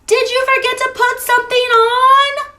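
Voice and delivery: a mother calling across the yard. Acted.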